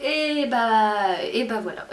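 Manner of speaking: normal talking voice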